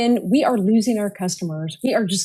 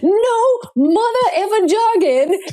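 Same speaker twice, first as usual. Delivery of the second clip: higher, slow, hammed-up Samuel Jackson growl; Samuel Jackson impression; 9 semitones higher, slower than usual. Mocking jargon in meetings, slow hammed-up Samuel Jackson voice. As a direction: play it like a movie tough guy laying down the law. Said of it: "And he goes".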